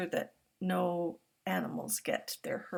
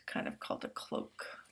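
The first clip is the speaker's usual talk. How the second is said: ordinary delivery, nothing marked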